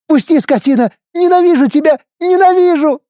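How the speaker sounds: hateful scream. Acted. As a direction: scream the hatred at him. Wife screams hatred. (крик ненависти)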